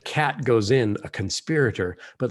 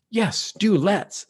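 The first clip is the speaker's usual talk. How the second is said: put-on eager boy; 8 semitones higher, 5 dB louder, slower than usual. Eustace eager agreement.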